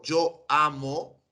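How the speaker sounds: "yo amo" spoken very slowly and deliberately; slow demo of "yo amo"; slow example. Shown as a demonstration. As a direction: spell out "yo amo" for the class. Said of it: "Pero si yo digo".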